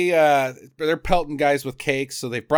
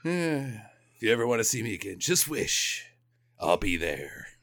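low, soft, halting hero voice; mock hero farewell; 7 semitones lower, 8 dB quieter than usual. Elfman promises to return, low soft mock solemnity. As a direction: lower and hush it like a solemn superhero.

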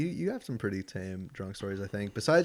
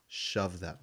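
sleep talking; 4 semitones lower, 7 dB quieter than usual. Retold or imitated. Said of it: "you just say the words".

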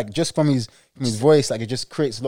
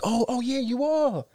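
mock surprise; 10 semitones higher, slower than usual.

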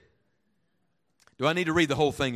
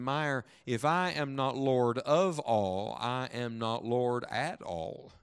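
slow, measured divine answer; acted solemn reply, drawn out; solemn pronouncement; 3 semitones lower, 3 dB quieter, much slower than usual. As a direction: lay down each word slowly and firmly. Voices the Lord's solemn answer.

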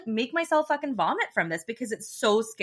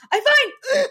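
high, tight cry of I'm fine; choked high protest; strained high "I'm fine"; 7 dB louder than usual. Retold or imitated. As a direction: squeak it out through choking. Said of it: "'cause I'm like".

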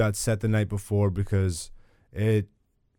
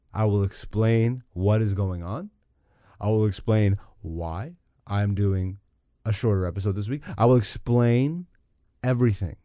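ordinary talk

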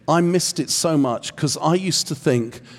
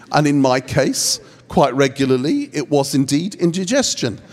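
usual speaking voice

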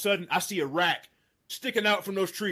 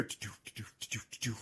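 whispered soft doo-doo footfall sounds; footsteps; 8 semitones lower, 15 dB quieter, faster than usual. Retold or imitated. Hunter imitating cows' hooves coming close, whispered. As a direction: breathe out the elk's feet patter. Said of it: "all of a sudden I hear".

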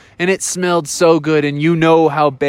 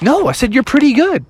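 higher-pitched, protesting story wolf; 9 semitones higher, faster than usual. Acted. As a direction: protest your innocence in a raised pitch.

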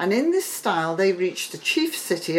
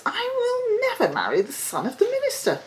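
high, quick, half-laughing princess; a girl laughing off the match; high and fast with a laugh. A narrator's reading. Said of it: "replied the princess".

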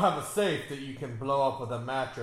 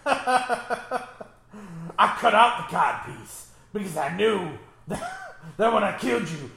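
laughing; 3 semitones higher, 8 dB louder than usual. Real, not acted. Laughing through a crude joke.

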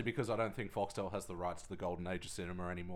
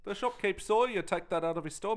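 higher-pitched mock Kiwi cop accusing; hammed-up New Zealand cop, mate; 8 semitones higher than usual. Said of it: "Police ten seven, bro".

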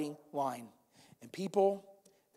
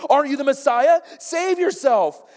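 mocking yell; 7 semitones higher, 7 dB louder than usual. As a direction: sneer it up at him from the cross. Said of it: "began to yell insults at him".

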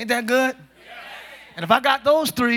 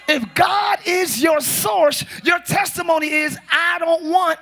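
full-force preaching; 4 semitones higher, slower than usual. Acted.

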